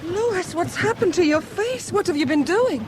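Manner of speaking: shocked concern at his face; startled worry; alarmed. Acted. Shocked to see his swollen face.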